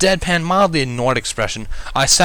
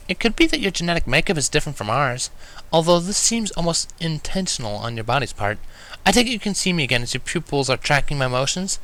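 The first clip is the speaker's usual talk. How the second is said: a touch higher, earnest curious mare; lightly voiced Twilight; 6 semitones higher than usual. A narrator's reading. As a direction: explain it like a fascinated young scholar.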